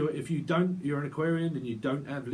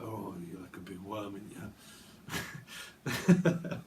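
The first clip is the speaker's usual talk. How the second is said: creepy TV character impression; 4 semitones lower than usual. Quoting a Ricky Gervais show, low creepy impression. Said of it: "reminds me of that Ricky Gervais movie".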